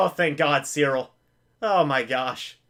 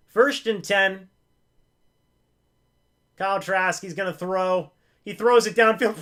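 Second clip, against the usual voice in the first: announcer calling the snap and throw; play-by-play call; 3 semitones higher, 4 dB louder than usual. Acted. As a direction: build the call as the ball goes downfield. Mock play-by-play again.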